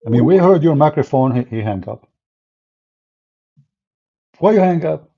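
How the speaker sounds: usual speaking voice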